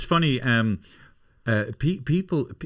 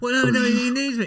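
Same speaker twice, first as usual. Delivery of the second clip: much higher mock whine, pleading; mimicking Mark pleading; 12 semitones higher than usual. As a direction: squeak it out like a fussing dad. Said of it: "the two of us are going".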